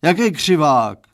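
loud, baffled shout; roaring. A narrator's reading. Father not understanding daughter's correction, roaring. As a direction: roar the question back. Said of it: "zařval pan Stránský".

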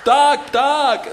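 very high, loud squawk of pain; pained yell. A narrator's reading. The third bird gets fallen on, pained yell. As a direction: squawk it high on one held note. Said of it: "zařval bolestí pták".

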